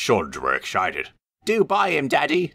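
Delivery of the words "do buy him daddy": put-on small boys begging, much higher voice; children's high pleading voices. A narrator's reading. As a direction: beg your father for the engine. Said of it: "they pleaded".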